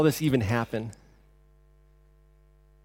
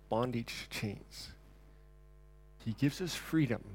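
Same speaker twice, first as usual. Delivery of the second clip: hushed closing sermon; 4 semitones lower, 9 dB quieter than usual. Acted. Preacher closing the sermon over music, softer and hushed. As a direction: bring the congregation down gently, much softer.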